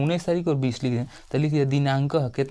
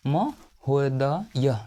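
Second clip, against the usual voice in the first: slow dictation; much slower than usual. Shown as a demonstration. Teacher dictating महोदय to students, very slow. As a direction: stretch महोदय out syllable by syllable, very slow. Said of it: "teacher spells word for students".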